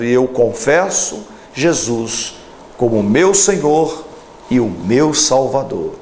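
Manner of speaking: ordinary delivery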